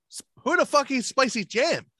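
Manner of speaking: high-pitched, stammered start of disbelief; incredulous. Retold or imitated. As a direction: retell it as baffled protest. Retelling his disbelief at spicy jam.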